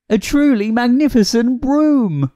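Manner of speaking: high-pitched, marvelling narration; awed excitement. A narrator's reading. Narrator revealing the magic new broom, marvelling. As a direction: announce it high, with wonder.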